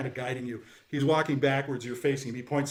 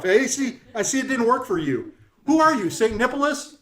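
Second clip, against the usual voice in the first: high, loud insult-comic voice; pitched-up showman delivering jibes; brash heckling jokester; 7 semitones higher, 5 dB louder than usual. Acted. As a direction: play a boisterous greeter roasting the guests. Jokester figure teases a player.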